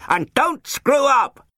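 slow, high, full-force shout; officer yelling a warning at his recruits. A narrator's reading. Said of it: "he shouted".

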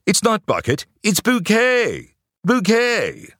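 very high, slow posh English lady; plummy English accent. Retold or imitated. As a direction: stretch Bouquet out like a snob. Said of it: "in her plummy English accent".

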